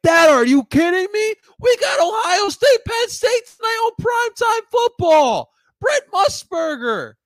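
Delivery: high, loud pleading shout; whiny teen protest. Retold or imitated. Kid begs dad to stay home for football, whiny shout. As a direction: whine and yell like a kid denied his game. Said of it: "And I'm like".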